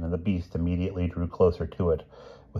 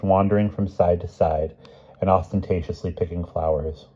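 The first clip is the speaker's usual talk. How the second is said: plain reading voice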